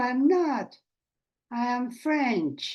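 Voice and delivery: normal talking voice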